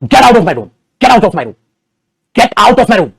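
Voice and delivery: loud, forceful get out of my room; angry scolding. Shown as a demonstration. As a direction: order him out with real anger. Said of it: "वैसे English में डांटना".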